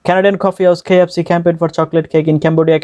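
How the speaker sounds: connected tongue-twister run without pauses; all run together. Shown as a demonstration. Teacher shows the whole thing at once, run together. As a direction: roll straight through in one even stream. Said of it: "এবার সম্পূর্ণটা একসাথে শুনুন".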